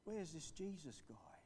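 whispered, puzzled inner question; whispered thought. Retold or imitated. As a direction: whisper it to yourself, searching the room. Thinking while looking in.

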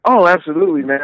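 usual speaking voice

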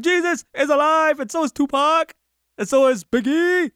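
high street-preacher proclamation; preachy proclaiming. Pretend. Man on a crate announcing to the crowd, high preachy call. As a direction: belt it like a sermon to strangers. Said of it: "I don't know why you say it like that".

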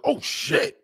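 high, strained exclamation; high-pitched outburst while holding back crying; retold voice close to breaking; fighting back tears. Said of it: "I'm trying to fight it".

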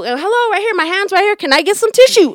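retold annoyed call for attention; getting pissed. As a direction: call out with mounting irritation. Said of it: "I start getting pissed".

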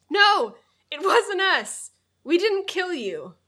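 high, loud acted protest; dramatic high-pitched shout in character. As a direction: cry out in protest at a ghost. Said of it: "Harry shouted".

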